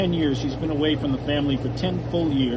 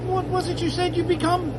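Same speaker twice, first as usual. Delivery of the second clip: hopeful father; 13 semitones higher than usual. Acted. Father asks again, hopeful.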